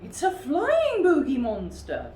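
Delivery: high, loud amazed bear voice; a bear pointing up in wonder; remarking bear. A narrator's reading. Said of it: "remarked the one with".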